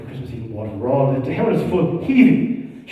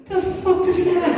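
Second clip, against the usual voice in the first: mock startled priest; 12 semitones higher than usual. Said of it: "the priest even looked at him and said".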